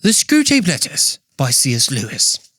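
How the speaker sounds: C S Lewis impression in British accent; British narrator voice. Shown as a demonstration. Podcaster announces the book in a chosen narrator voice, British accent. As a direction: read the title like a British narrator. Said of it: "I gotta choose my narrator voice".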